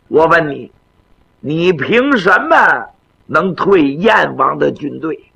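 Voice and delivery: grandfather emperor, gently admonishing. Acted. Emperor warns his eager grandson to be modest, gently admonishing.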